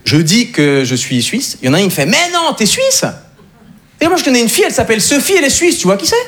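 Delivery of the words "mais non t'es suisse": high-pitched, exaggerated disbelief; mock amazement. Frenchman learning he is Swiss, mock amazement. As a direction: react with hammed-up surprise, voice shooting up.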